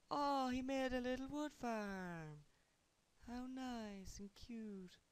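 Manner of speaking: high, slow cooing; sarcastic baby-talk high voice; mock sweet voice. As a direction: coo over it patronisingly. Mocking enemy's little farm.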